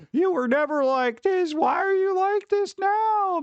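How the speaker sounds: high, slow, shocked mock whine; shocked whiny friend. Mocking a shocked friend who ignored advice, high and slow. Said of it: "they're all shocked and aghast".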